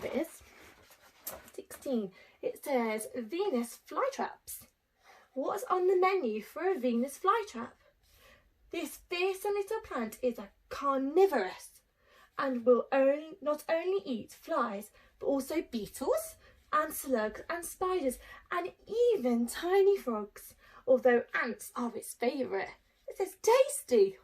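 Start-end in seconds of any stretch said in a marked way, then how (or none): 3.1-22.8 put-on reading to children. Teacher reading the plant book to pupils, lively. 23.4-24.2 high, loud gleeful "Tasty"; relishing tasty. Acted. Teacher voicing the greedy flytrap, gleeful. Book's joke, voiced with relish.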